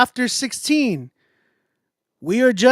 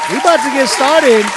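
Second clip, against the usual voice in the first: loud, higher-pitched hype call; hyped announcement; 5 semitones higher, 9 dB louder than usual. Real, not acted. Streamer telling viewers it's about to start, hyped shout. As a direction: call it out loud to rally everyone.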